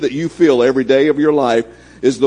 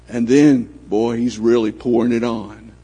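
ordinary talk

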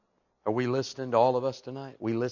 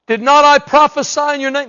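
pleading preacher; 12 semitones higher, 14 dB louder than usual. Acted.

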